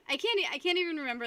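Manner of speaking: normal talking voice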